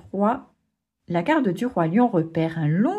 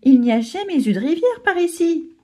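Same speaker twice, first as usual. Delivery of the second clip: alarmed; 7 semitones higher, 4 dB louder than usual. Acted. Fouli panicking at flood.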